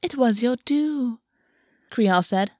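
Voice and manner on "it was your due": higher and slow, sweetly serene; a cruel line said with a saintly smile; smiling beatifically. A narrator's reading. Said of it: "smiling beatifically".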